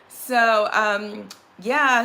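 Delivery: usual speaking voice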